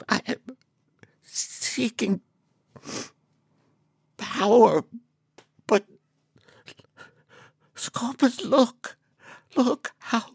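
high, slow, breathy awe; high breathless creature voice. Acted. Helgrammet in awe.

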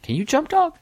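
very high, squeaky mock voice; high-pitched jokey questioning; imagined look voiced. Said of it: "a look in your eyes like".

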